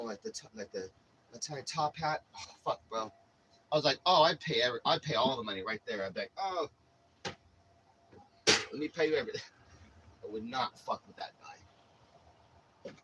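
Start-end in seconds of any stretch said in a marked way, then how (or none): 4.0-9.4 higher, louder hammed-up begging; exaggerated scared offer to pay everything; mock pleading. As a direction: beg like your life depends on it. Imagined begging the hitman.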